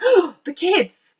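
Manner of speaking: high, loud, slow gasped realisation; suddenly remembering the children, slow and high. Retold or imitated. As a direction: gasp as it dawns on you. Realising she must return.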